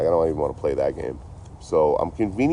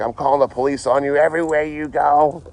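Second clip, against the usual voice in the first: higher, lightly voiced angry viewer; mock threatening viewer; 8 semitones higher, 4 dB louder than usual. Quoting yesterday's live-stream heckler, mild mock threat. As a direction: imitate the guy threatening him. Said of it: "He's like".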